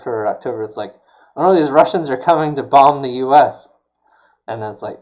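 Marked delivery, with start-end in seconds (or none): 1.4-3.7 loud, exaggerated mock panic; mock alarm. Host voicing a thriller's apparent plot, mock alarm. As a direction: sound the alarm, hammed up.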